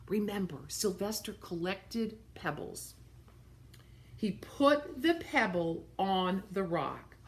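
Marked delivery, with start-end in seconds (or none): none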